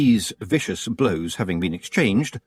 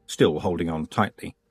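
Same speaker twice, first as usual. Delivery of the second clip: ordinary delivery, nothing marked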